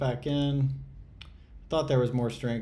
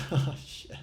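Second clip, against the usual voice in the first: nervous laugh; 5 semitones higher than usual. Real, not acted. Risky all-in trade just sent.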